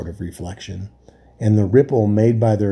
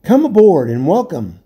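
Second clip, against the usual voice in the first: louder, high hail; king hailing a swimmer in the dark; calling out welcome; 7 semitones higher, 5 dB louder than usual. A narrator's reading. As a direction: call him aboard. Caspian calls out.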